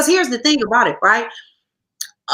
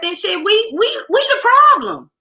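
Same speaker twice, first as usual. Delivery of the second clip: heated and pitched higher, building up; riled-up higher-pitched tirade; fired up; 7 semitones higher than usual. Real, not acted. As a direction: rant like you're fed up with the council. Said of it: "you got spicy now".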